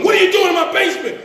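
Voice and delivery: loud, high, fast demand; angry father. Retold or imitated. Father with a gun confronts the intruder, loud fast angry demand. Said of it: "The father pulls out his gun".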